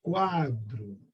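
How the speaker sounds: "quadro" drawn out on a low, soft "qua", then falling; weighted first syllable. Shown as a demonstration. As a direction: give "qua" in "quadro" weight without force. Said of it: "ela tem peso".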